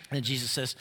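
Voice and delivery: usual speaking voice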